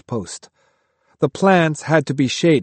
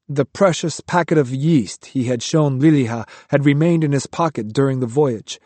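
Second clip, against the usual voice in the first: usual speaking voice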